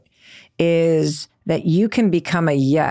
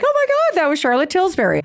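much higher, squealing disbelief; starstruck excitement; 10 semitones higher, faster than usual. Retold or imitated. Host reacting after meeting a celebrity, much higher and thrilled. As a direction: squeal it like you just met a star. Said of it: "I'm like".

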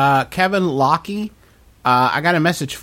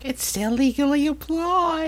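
high, softer squeaky character voice; silly put-on voice; 11 semitones higher, 4 dB quieter than usual. Host reassuring co-host about legal text, silly high voice. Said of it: "What is this voice?".

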